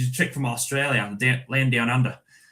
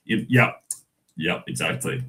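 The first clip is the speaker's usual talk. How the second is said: usual speaking voice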